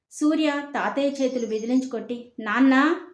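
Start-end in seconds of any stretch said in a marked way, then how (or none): 2.4-3.0 calling out loud for his father; a forceful raised call, louder than the narration. Acted. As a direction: shout the word across the room. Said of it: "గట్టిగా పిలిచాడు".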